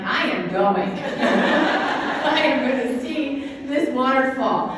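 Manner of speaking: slow, laughing insistence; laughing but determined. Retold or imitated. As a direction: laugh and insist you're going. Determined reply to husband, laughing.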